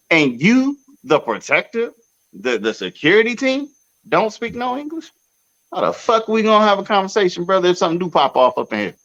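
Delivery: high, fast disbelieving question; can't believe it, speed up at the end; incredulous rant. Real, not acted. Asking friends how security can't speak English, high and fast. Ranting about the security team.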